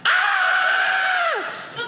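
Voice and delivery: huge high screaming yell; troll scream. Acted. Half-naked troll screaming at the crowd, wild scream. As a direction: let rip a shrill troll scream.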